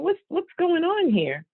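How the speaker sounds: wondering aloud what on earth is happening; puzzled child. Retold or imitated. Child watching Daddy's odd behaviour, puzzled.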